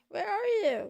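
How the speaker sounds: calling after something that vanished. Real, not acted.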